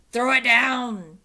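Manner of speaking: loud slow pirate demand; captain crying out for the medallion. A narrator's reading. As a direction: bellow it up. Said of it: "cried Captain Bones".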